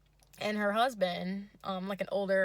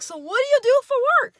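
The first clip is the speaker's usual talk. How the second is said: far higher, louder and fast goofy squeal; yay voice; 14 dB louder, faster than usual. Retold or imitated. Date squeals at the tiramisu in a goofy voice. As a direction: squeal it like an overexcited man. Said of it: "yay voice".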